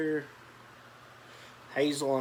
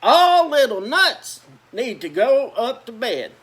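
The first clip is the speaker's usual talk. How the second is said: louder, higher stern mother; mother ordering nuts to bed, louder; big mama voice; 8 semitones higher, 9 dB louder than usual. A narrator's reading. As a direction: call it out bigger. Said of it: "in her big voice".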